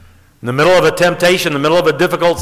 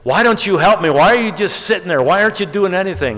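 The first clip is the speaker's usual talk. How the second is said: acted exasperation, pitched high; exasperated; 5 semitones higher than usual. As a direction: plead in storyteller's mock exasperation. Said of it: "he just got completely exasperated".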